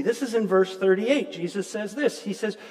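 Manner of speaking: ordinary talk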